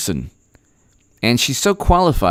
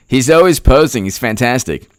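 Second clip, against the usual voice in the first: ordinary talk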